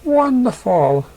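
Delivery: high, slow, delighted wonderful; a sing-song delighted mare. Acted. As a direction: savor it with a giggle behind it. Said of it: "with a giggle".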